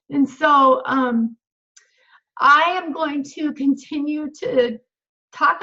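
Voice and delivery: slow, halting, on the verge of crying; real, near tears. Real, not acted. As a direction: fight back tears while paying tribute to a friend. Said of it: "Oh, I'm gonna cry now".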